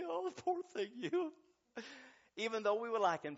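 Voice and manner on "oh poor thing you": high-pitched cooing sympathy; poor-baby voice, pitched way up; mock pity. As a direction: fuss over them with exaggerated pity. Mock pity God will not give.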